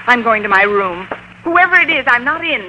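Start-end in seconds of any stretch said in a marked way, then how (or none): 1.4-2.7 calling out. Acted. Connie calling out to landlady.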